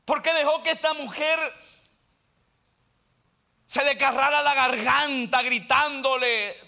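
loud, high fervent sermon delivery; full-force preaching. Real, not acted. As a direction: proclaim it from the pulpit, pressing every phrase.

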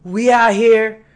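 high, loud, slow trash talk; hyped boast. Retold or imitated. Bronco fan boasts to the front desk guy, loud and hyped. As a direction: hype yourself up before the game.

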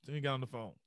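ordinary talk

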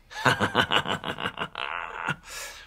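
cheeky villain cackle; mischievous baddie laugh; evil laugh. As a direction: cackle like a naughty little pea.

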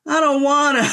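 put-on sulky grumbling kid; whiny grumble. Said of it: "I'm not thrilled because it's like".

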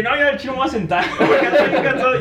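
half-laughing fed-up protest; laughing mock exasperation. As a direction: laugh through the complaint.

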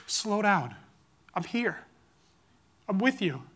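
soft, reassuring fatherly voice; comforting voice. Acted. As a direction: speak softly, as a steadying inner voice. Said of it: "It's comforting".

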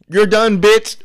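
high, loud, emphatic kiss-off; mock dismissal. Mock boss firing someone.

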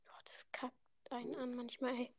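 soft, unvoiced grumble; whispered gripe. Real, not acted. Player whispers a swear aside so listeners miss it. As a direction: hiss it under the breath.

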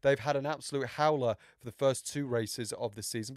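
normal talking voice

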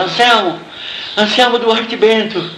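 a scared kid pleading through tears, mock-replayed; boy caught under the wall, answering the soldier in fright; retold crying boy. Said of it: "E eu chorando".

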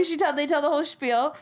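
usual speaking voice

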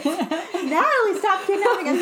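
laughing it out, slow and loud; laughing tease. Real, not acted. Friend teasing Natalie about kidnapping the kid, laughing. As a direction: tease her mid-laugh, voice up high.

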